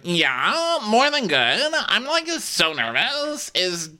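high, put-on girlish voice; hammed-up high-pitched date; nervous girl imitation. Voices nervous girl before a dance.

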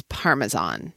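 Parmesan with a hard z, PAR-muh-zahn; American pronunciation. Shown as a demonstration. Host arguing for the American way, Parmesan with a z. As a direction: say "Parmesan" the plain American way, z not zh. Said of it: "say it the American way".

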